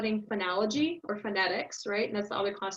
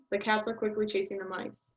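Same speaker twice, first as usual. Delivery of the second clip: unstressed words sped up. Shown as a demonstration. Teacher showing the same length for a longer sentence, quick small words. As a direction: rush "are quickly" and "the", stress cats, chase and mice. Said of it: "I speed up those other words that are not as stressed".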